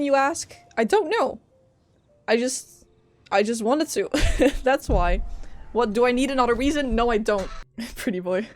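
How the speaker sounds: ordinary talk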